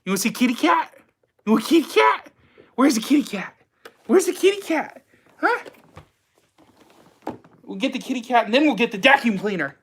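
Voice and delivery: put-on high baby talk to a pet; reading to a toddler. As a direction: coo at the dog, high and sing-song.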